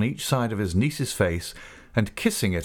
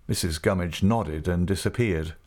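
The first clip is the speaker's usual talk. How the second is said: ordinary delivery, nothing marked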